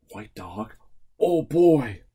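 ordinary talk

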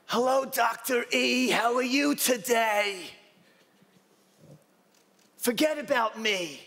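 put-on high, bright old-lady voice; Flavie imitation. Patient greeting her doctor, high old-lady voice. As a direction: do your elderly patient. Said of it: "It's my Flavie imitation".

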